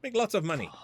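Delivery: put-on cheerful Chinese-accented shopkeeper. Woman greeting Majima, Chinese accent. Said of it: "Not the same without the accent".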